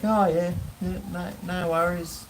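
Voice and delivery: the dog voiced as if nothing happened; carefree dog. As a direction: play the dog shrugging it off. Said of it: "he's like".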